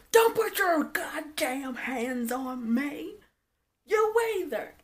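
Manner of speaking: slow, drawn-out, high mock fury; mock yelling. [name] warns them off touching her, mock yelling. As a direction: stretch each word in hammed-up outrage. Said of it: "start yelling".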